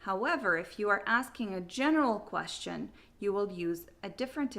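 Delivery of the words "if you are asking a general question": normal talking voice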